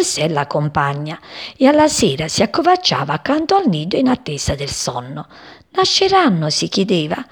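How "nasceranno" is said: high-pitched wondering question. A narrator's reading. Sparrow father asks his mate about the eggs, high and wondering.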